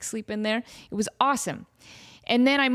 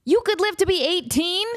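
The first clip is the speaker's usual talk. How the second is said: high, incredulous exclamation; astonished; 9 semitones higher, slower than usual. Real, not acted. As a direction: squeal the number in disbelief. Reads cat lifespan, astonished.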